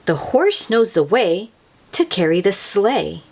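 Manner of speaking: ordinary delivery, nothing marked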